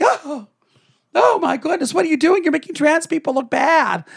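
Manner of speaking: high-pitched mock outrage of objectors; mock dismay. Imagined critics scolding the speaker, mock dismay. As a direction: imitate scandalized critics in a high voice. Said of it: "maybe some people will think".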